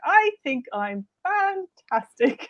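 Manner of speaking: slow, self-assured I think I'm fantastic; proud mantra. Retold or imitated. Grandmother's confident mantra, said slowly and proudly. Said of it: "She just had that attitude".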